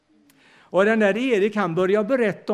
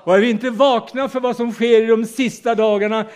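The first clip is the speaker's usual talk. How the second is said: preaching; 3 semitones higher, 4 dB louder than usual. Acted. Sermon climax on last days.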